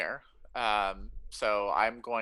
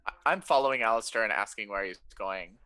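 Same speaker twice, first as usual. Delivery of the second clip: ordinary delivery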